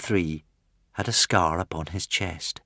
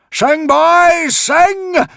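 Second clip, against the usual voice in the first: very high, loud, frantic yell; desperate roar; 10 dB louder, much slower than usual. A narrator's reading. Gob urging the Vikings to sing, desperate roar. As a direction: scream the order as if lives hang on it. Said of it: "roared Gob o' the Belch desperately".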